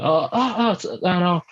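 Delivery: hammed-up lost foreign tourist asking for a photo; fake non-English speaker. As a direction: play a foreigner who barely speaks English. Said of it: "the I don't speak English".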